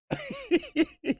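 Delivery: very high squeal of laughter. Real, not acted. Laughing at Rolly's excuses.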